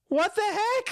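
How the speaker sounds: high-pitched, bewildered outburst; shocked disbelief. Retold or imitated. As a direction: react as if blindsided by the news. Retold reaction to alarming call.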